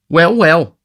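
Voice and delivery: high and loud "well, well"; loud interjection. A narrator's reading. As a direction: cut in loudly. Dodger interrupting loudly.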